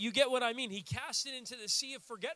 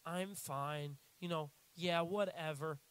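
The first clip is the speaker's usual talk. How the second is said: a lightly sulking, lower "I'm fine"; sulky "I'm fine"; 5 semitones lower, 4 dB quieter, slower than usual. Retold or imitated. Needy girlfriend pouting to her partner, lightly sulky. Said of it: "being all like".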